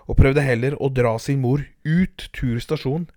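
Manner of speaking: usual speaking voice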